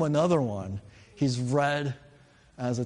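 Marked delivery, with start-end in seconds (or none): none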